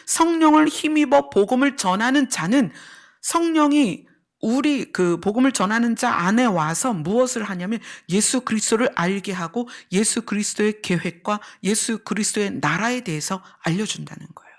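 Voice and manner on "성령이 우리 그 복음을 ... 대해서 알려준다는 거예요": ordinary delivery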